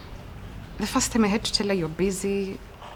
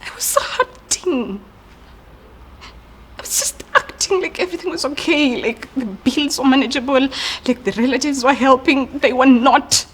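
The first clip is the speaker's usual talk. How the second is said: pained, high-pitched confession building louder; hurt outburst; 4 semitones higher, 4 dB louder than usual. Acted. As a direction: let long-held hurt spill out, rising in force. Hurt sibling confesses hidden pain.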